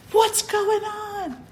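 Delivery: high, slow alarmed cry, imitated; grandma bursting in, startled.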